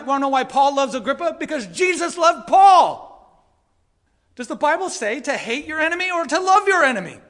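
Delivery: very loud, high, stretched-out preaching; full-force pulpit shout; impassioned yelling. Real, not acted. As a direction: yell it out to the church with fervor. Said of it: "should I be yelling like that".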